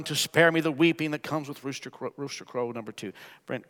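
usual speaking voice

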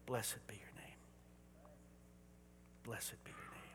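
breathy hushed whisper, barely voiced; whispered praise. Real, not acted. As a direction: whisper it like a prayer. Whispered worship refrain.

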